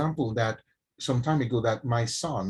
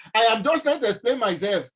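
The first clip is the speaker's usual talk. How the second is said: put-on loud, high Ondo-accented protest; indignant retold outburst in Ondo accent; angry Ondo accent; 7 semitones higher, 5 dB louder than usual. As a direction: slip into a heated Ondo accent. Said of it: "go back to the Ondo accent".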